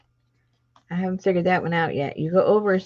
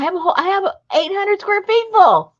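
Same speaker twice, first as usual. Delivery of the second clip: incredulous exclamation; 13 semitones higher, 7 dB louder than usual. Real, not acted. Exaggerating huge fabric stash.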